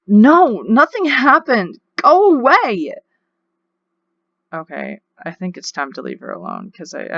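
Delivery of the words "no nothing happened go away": loud, rising yell; angry outburst. Acted. Upset teen daughter to her dad, loud angry yell. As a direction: shout him out of the room. Daughter yells at father to leave.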